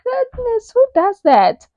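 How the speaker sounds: very high, breathy-free squeal of shock; scandalized shock. Retold or imitated. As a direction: act utterly scandalized. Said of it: "I was like".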